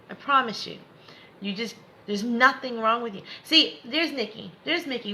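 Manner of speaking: usual speaking voice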